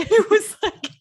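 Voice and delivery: words break into a laugh. Real, not acted. Friend laughs while retelling the cruel line, high. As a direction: giggle through the lead-in.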